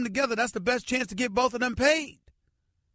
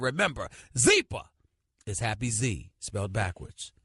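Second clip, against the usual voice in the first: ad read; 8 semitones lower than usual. Acted. Host reads a sponsor ad.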